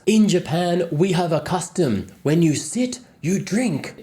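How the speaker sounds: high, loud mock rage in broken English; imitated angry yell. Japanese man scolding two guests for leaving, imitated angry yelling. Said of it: "in loud English he yells".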